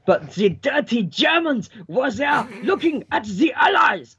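over-the-top German teacher's proclamation; mock German accent. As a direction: declaim it like a cartoon German. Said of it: "Is he doing a German accent?".

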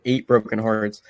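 ordinary delivery

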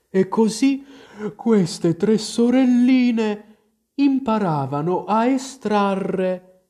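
slow, high drawling creature voice; resume the tale as if nodding off; drowsy, stretched-out high storytelling; sleepy Dormouse. A narrator's reading.

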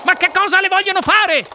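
high-pitched alarmed mother; crying out. A narrator's reading. Mrs Salt calls her seized daughter back, crying out. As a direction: call out urgently, pitched right up. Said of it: "gridò la signora Salt".

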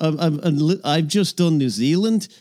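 lightly put-on Scottish with a Liverpool slide; a Scots voice drifting into Scouse; Scottish accent slipping to Liverpool. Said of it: "You're slipping a bit into Liverpool".

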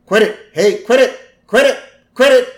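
put-on escalating, high, loud kid protest; increasingly shrieky mock sibling complaint; Lisa. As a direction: build from annoyed to yelling for Mom. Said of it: "Lisa's like".

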